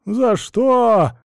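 high, loud plaintive whine; whining. A narrator's reading. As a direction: wail it like an injured party. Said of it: "проныл он".

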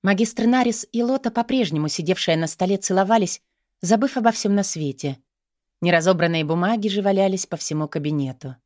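usual speaking voice

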